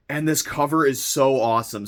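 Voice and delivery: ordinary talk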